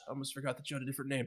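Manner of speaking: normal talking voice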